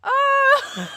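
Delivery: loud, high howling laughter; helpless shrieking laughter; real laughing fit. Real, not acted. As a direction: crack up at the clip. Bursts laughing at a clip.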